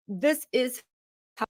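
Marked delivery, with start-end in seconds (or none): none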